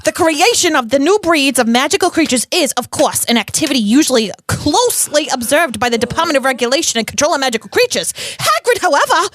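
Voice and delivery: New York columnist, rising for drama; Rita Skeeter. Acted. Rita resuming her exposé on Hagrid, New York accent. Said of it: "Rita has a New York accent".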